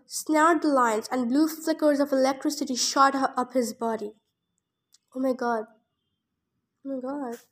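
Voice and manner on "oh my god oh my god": shaken. Real, not acted. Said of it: "guys, I can't read anymore".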